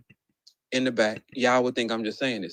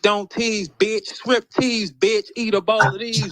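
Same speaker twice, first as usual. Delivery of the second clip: higher mock rap bars; cursing battle rappers; 6 semitones higher, 3 dB louder than usual. Showing what everybody here says, raised mock battle-rap delivery. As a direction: chant the crude lines in a raised voice. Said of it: "Everybody here says".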